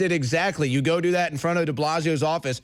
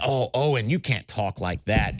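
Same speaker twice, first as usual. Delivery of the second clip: mock scolding critic; 7 semitones lower than usual.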